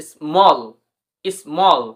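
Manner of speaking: স্মল with the aw vowel and clear l; small demo. Shown as a demonstration. Teacher models small after spelling, clear and slow. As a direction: sound out স্মল twice. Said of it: "S M A L L".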